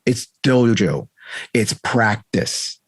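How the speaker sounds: each word weighed, slow; slow and deliberate. Shown as a demonstration. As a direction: spell it out slowly. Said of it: "It's that old saying".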